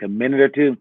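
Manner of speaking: ordinary delivery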